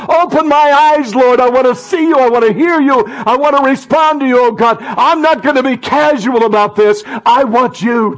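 high, loud impassioned prayer; crying out to God. Shown as a demonstration. Preacher showing how to cry out to God, impassioned shout. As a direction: belt out the plea with all your might. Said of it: "it's time to cry out to God".

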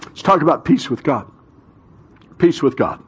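ordinary talk

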